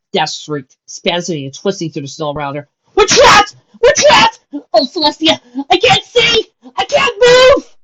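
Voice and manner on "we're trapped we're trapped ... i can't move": high, loud, crying panic; panicked crying. A narrator's reading. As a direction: sob and shout in terror. Dash panics buried in snow.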